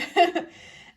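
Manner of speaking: loud bright laugh. Real, not acted. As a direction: burst out laughing. Laughing at her own burp.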